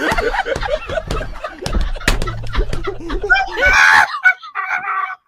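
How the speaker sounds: long, high-pitched helpless laughter; hard laughing fit. Real, not acted. Streamer stopping to laugh at the clip, hard laughing fit. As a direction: laugh uncontrollably, rising into shrieks. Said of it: "let me laugh".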